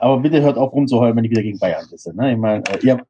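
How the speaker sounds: usual speaking voice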